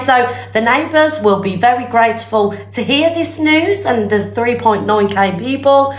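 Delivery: high, gleeful town-crier delivery; mock announcement. Streamer announcing a singer's sore tongue to viewers, mock announcement. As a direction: proclaim it to the nation in a high voice.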